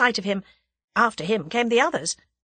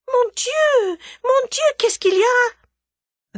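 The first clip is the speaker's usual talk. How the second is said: much higher, louder French cry of alarm; 6 dB louder than usual. A narrator's reading. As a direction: burst in crying out in French. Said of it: "with a loud cry".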